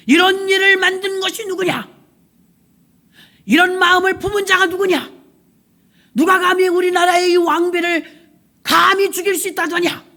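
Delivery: high, loud royal outrage; angry king. Acted. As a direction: thunder at the court like a furious king. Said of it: "왕이 막 화가 났어요".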